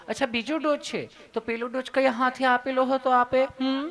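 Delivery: high, put-on nurse voice; sweet, loving nurse. Nurse checking the patient's doses, high and sweet. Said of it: "તમે કેટલું પ્રેમથી પૂછો છો".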